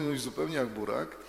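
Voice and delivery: plain reading voice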